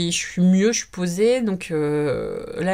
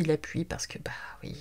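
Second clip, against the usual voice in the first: répété dans un souffle; le redire tout bas, sans voix; chuchoté; 9 dB quieter than usual. Real, not acted. Repeats the word, then whispers it. (murmure complice)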